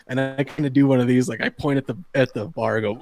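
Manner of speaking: ordinary talk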